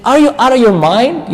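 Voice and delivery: imitating a furious cry of disbelief; losing it. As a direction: blurt it out in disbelief. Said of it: "Musa loses it completely".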